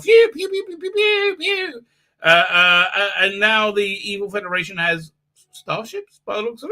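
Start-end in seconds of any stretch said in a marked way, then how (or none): 0.0-1.8 laser and gunfire noises. Retold or imitated. Fan recapping the chase: high mouthed laser fire. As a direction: make childlike laser-gun noises. Imitating ship weapons fire.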